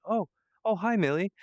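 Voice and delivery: high startled double oh, then greeting; Randy. Acted. Distracted character suddenly notices Millie, surprised. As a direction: greet her as if just noticing. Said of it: "and he's like".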